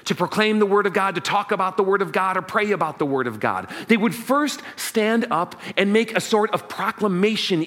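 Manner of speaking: usual speaking voice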